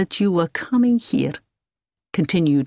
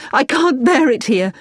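higher, louder anguished cry; distressed plea; 8 semitones higher, 5 dB louder than usual. Acted. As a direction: let the despair break out. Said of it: "hiding her face in her hands".

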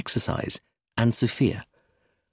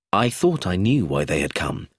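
ordinary delivery, nothing marked

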